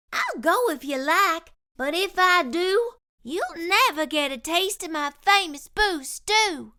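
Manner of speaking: lightly voiced plucky cook; Curly Locks voice. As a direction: stand your ground, sweetly stubborn.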